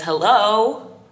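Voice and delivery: ordinary delivery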